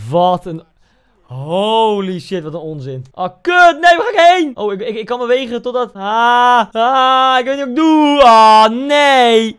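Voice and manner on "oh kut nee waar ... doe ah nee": high, loud frantic cries; panicked yelling. Real, not acted. Gamer panicking at a chaotic moment, loud. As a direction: yell in panic as it goes wrong.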